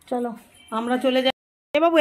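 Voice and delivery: ordinary talk, nothing marked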